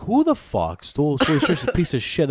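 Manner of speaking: hammed-up fury at the shirt thief; outraged yell. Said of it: "I'm like".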